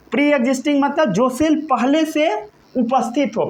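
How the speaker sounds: normal talking voice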